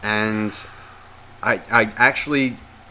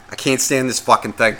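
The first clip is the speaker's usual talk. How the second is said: ordinary talk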